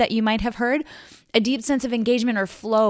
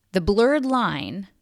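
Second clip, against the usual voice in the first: normal talking voice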